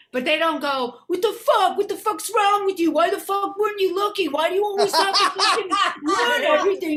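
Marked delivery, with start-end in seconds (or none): 1.1-7.0 high, loud, furious ranting; imitated angry yell. Imagined partner screaming about the dented car, furious. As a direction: rant like a fed-up partner.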